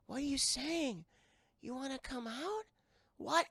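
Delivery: put-on small girl's puzzled questions; curious little girl.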